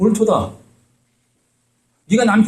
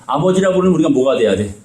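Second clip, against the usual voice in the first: ordinary talk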